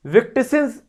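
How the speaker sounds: wrong doubled ending on विक्टिम्स, "victisins". Shown as a demonstration. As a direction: garble an extra s into विक्टिम्स. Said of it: "ये तो गलत बोला गया".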